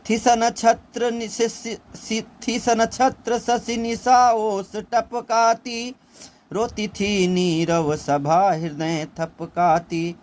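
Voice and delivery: Kaikeyi's lament recited slowly as verse; poem recitation. Acted. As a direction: lift the line and stretch it like recited poetry.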